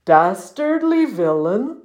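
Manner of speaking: slow, questioning muskox voice; incredulous echo. A narrator's reading. Muskox answering the puffin's insult, incredulous. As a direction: repeat it slowly in disbelief.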